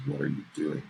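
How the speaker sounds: retelling the stranger's cool, unhurried challenge; calm menacing man. Retold or imitated. Podcaster retelling a man on a recording, calm and calculated. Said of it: "the tone in his voice is".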